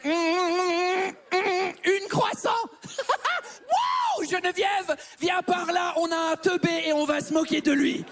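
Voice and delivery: high, slow incredulous jeer; mocking baker. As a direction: laugh at the foreign customer. Baker mocks his mistake. (boulangère qui se moque, aiguë)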